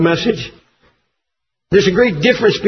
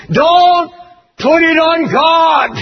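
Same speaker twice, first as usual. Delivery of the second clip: shouted rebuke; 6 semitones higher, slower than usual. Real, not acted. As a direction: thunder the rebuke at the TV preachers. Preacher rebukes TV preachers.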